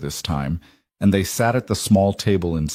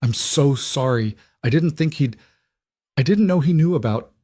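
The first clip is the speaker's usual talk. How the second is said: choked up; 5 semitones higher than usual. A narrator's reading. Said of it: "she choked out".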